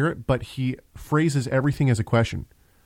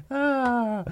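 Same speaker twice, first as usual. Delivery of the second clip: hearty laugh; 11 semitones higher, 3 dB quieter than usual. Real, not acted. Laughs at co-host's outburst.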